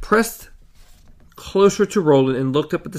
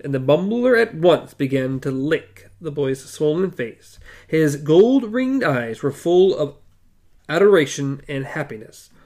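ordinary delivery, nothing marked

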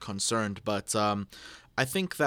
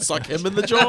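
silly voice; 9 semitones higher, 9 dB louder than usual. Retold or imitated. Repeating the silly quote.

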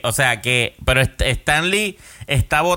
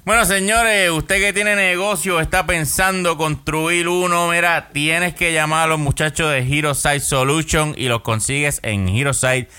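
voz de comercial de radio; lectura de anuncio; 4 semitones higher than usual. Acted. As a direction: vende como locutor de radio. Lee el anuncio del patrocinador. (anuncio publicitario)

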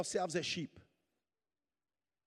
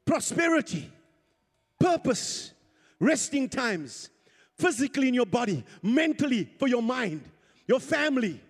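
raised, emphatic preacher's declaration; forceful preaching; 3 semitones higher, 5 dB louder than usual. Real, not acted. Preacher declaring God's favor upon the congregation, forceful preaching. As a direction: proclaim each blessing over the congregation.

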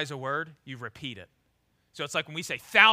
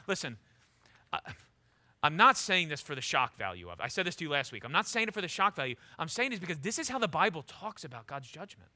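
ordinary talk

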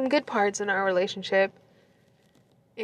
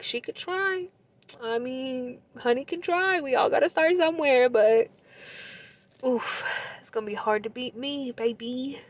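soft, high, doubtful lilt; condescending sass; 4 semitones higher, 3 dB quieter than usual. Retold or imitated. Boasting to the ex about the rival, condescending. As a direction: go light and high with mock generosity.

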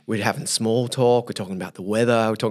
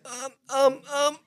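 awkward hesitation; 3 dB quieter, slower than usual. Retold or imitated. Retold awkward hesitation before confessing.

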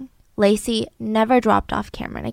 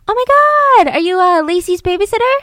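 surprised stranger; 7 semitones higher, 5 dB louder than usual. Retold or imitated. Stranger stunned at the park.

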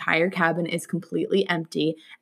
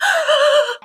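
sharp, hammed-up high gasp; fake gasp; 5 dB louder than usual. Sister pretends to spot a ghost, mock gasp. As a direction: gasp as if seeing a ghost, overacted. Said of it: "fake looking up and being like".

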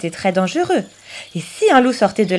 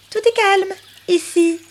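high, chirpy bird voice; a light, merry bird; cheerful little bird; 10 semitones higher than usual. Acted. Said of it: "gazouillait-il gaiement".

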